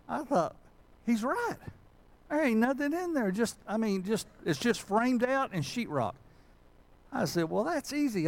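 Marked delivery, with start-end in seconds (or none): 1.1-6.1 high-pitched retold astonishment; amazed discovery. Preacher reliving peeking inside the hole, amazed. 7.5-8.3 high-pitched breezy self-mockery; mock confidence. Preacher retelling his first look at the job, mock confident.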